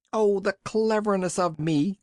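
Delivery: high, bright crow of self-delight; boy crowing over his own cleverness; joyful cry. A narrator's reading. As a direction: boast it, bursting with joy. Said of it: "cried Peter, overcome with joy".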